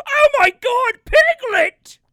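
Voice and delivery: high, loud hammed-up cry of horror; mock horror. Actor watching Piglet get mauled, overacted horror. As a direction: cry out melodramatically to a lost friend.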